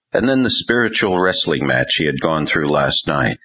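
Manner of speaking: usual speaking voice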